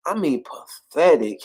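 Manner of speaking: ordinary talk, nothing marked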